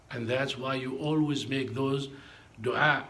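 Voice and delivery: usual speaking voice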